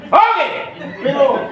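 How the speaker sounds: high, loud burst of energy; excited shout. Real, not acted. Host rallying the seminar crowd, loud excited shout. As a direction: call out high and loud to rouse the room.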